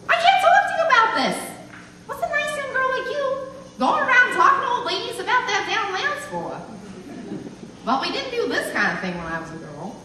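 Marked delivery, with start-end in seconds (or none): 0.1-6.6 high-pitched scolding of a nosy youngster; exasperated elderly outrage; indignant old woman. Acted. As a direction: protest in a high, scandalized voice.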